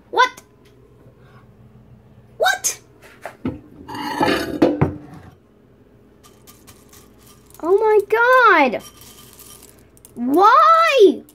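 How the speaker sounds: can't believe it happened again; shocked disbelief. Real, not acted. Vlogger to camera, shocked high disbelief. Noodles also fail.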